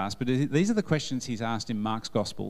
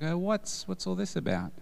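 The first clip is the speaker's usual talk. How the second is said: higher, bemused "what's all this"; mock puzzled Jesus; 7 semitones higher than usual. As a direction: play Jesus as mildly baffled by the fuss. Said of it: "Jesus is like".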